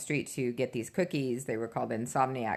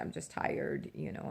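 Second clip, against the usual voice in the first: whispered excuse; 9 dB quieter than usual. Retold or imitated. Hiding her tears from her daughter.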